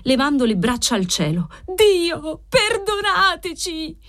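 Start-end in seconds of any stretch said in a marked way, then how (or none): 1.7-4.0 high, drawn-out wail for forgiveness; desperate cry. A narrator's reading. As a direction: beg heaven, pitch soaring, words stretched out. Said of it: "urlò lei con voce potentissima".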